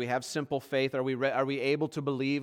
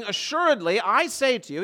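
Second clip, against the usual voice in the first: raised, louder, solemn declaration; very emphatic; 6 semitones higher, 5 dB louder than usual. Retold or imitated. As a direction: quote it with firm, ringing emphasis. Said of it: "he's very emphatic".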